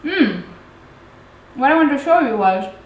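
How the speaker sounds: talking between bites of honeycomb; chewing while talking. Real, not acted. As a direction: mouth partly full. Seen 0: Bite just before; chewing through "Mm", talking after 4s.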